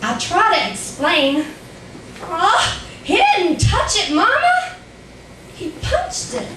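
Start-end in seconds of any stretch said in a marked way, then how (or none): 2.2-6.5 high-pitched protest to mama; childish explaining, pitch climbing high; pleading child. Acted. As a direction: plead upward like a misunderstood child.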